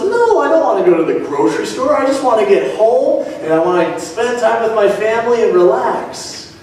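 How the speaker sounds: high-pitched, whiny-style mock protest; reluctant protest. Man balks at the errand after work, acted high protest. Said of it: "his initial response was".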